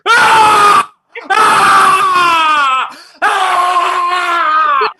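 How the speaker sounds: three long, high, full-throated screams; real release scream, as loud as you can. Real, not acted. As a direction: scream it all out, really loud. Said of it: "I want you to scream so loud".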